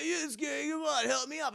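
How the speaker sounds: high, squeezed whiny voice; strained pleading. Retold or imitated. Dangling hero begging his friend for help, high strained plea. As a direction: whine it as if hanging on.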